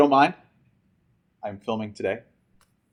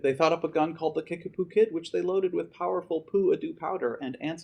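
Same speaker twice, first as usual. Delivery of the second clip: lively rhyming read-aloud to students; storybook reading; 7 semitones higher than usual. A narrator's reading. Teacher reading Dr. Seuss to seniors, lively and rhythmic. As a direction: read it with bounce, like story time.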